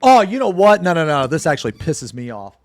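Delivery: loud, high angry outburst; ranting streamer blowing up; sudden real anger. Real, not acted. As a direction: erupt in genuine irritation. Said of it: "I got really mad really quickly".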